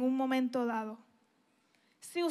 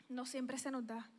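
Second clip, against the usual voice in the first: softer rueful repeat; 4 semitones lower, 15 dB quieter than usual. Shown as a demonstration.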